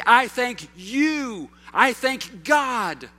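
I thank you, drawn out and loud; slow emphatic example. Shown as a demonstration. Preacher showing the right way, slow and emphatic. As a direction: lean hard on each word. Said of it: "You have to say".

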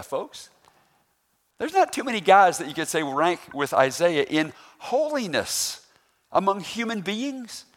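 ordinary talk

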